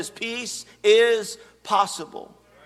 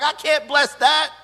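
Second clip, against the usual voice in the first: a higher, firmer voice of God refusing; God's reply; 7 semitones higher than usual. Acted. Pastor voicing God to a sinner, firm refusal. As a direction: speak as God turning down the request. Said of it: "He's like".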